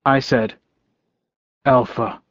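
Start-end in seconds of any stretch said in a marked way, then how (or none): 1.7-2.2 restrained, firm address; tightly controlled, understated anger; barely contained anger. A narrator's reading. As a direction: hold the anger just under the surface. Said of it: "my voice barely contained my anger".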